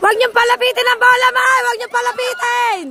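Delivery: urgent spectator yelling; shouting along. Real, not acted. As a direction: shout a warning to the team. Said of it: "pati ako sumisigaw na".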